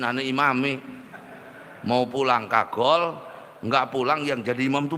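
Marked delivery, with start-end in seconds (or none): none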